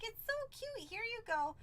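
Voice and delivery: high, sweet re-enacted handover; cutesy gushing. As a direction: play the delighted mom. Mother re-enacts handing over bat.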